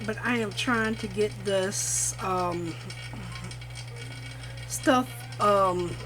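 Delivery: talking over a machine humming; machine noise behind. Real, not acted. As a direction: machine running in the background. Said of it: "sorry about the machine back in the back".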